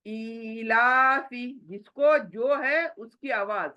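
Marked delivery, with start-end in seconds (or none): 0.1-1.6 ईलाफी with the ई drawn out long; lengthened ee. Shown as a demonstration. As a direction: stretch the opening ई of ईलाफी for a full beat. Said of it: "अभी यहाँ पर देखें". (ईलाफी में ई को लंबा खींचकर)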